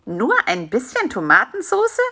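"bisschen" swooping up in disbelief, rising end on "Tomatensoße"; astonished query, "bisschen" stressed with a leap in pitch; incredulous echo question. Acted. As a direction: question "Nur ein bisschen" as if it can't be true. Fly echoes spider in disbelief.

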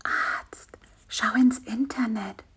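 very soft, breathed-out words; faint whisper. A narrator's reading. Feverish fly advises his friend, faint whisper. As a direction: whisper weakly from a sickbed. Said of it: "Bisi hauchte ganz leise".

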